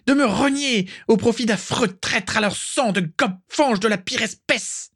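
high, furious tirade that keeps climbing; a proud witch berating a traitor; vociferating rant. Acted. Walburga berating the painter over her son, high furious rant. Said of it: "Tout en vociférant".

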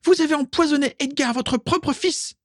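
very high, shrieky accusation; outraged cry. A narrator's reading. Carla accusing them of poisoning her, outraged. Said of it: "s'écria Carla".

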